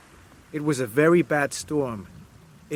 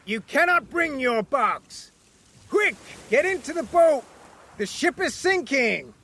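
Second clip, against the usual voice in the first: high, urgent shouted commands; captain shouting; 11 semitones higher than usual. Acted. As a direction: hurry him, voice pitched up. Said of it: "shouted the captain".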